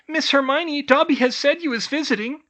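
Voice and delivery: high, loud squeaky elf voice; eager house-elf. A narrator's reading. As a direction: rush over and greet her. Elf Sonia greets Hermione.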